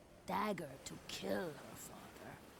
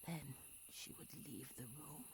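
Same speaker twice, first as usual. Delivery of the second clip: whispered storytelling, soft and secret; low bedside whisper; hushed whisper; 3 semitones lower, 10 dB quieter than usual. Acted. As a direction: breathe the words like a secret. Hushed story, tearful leaving.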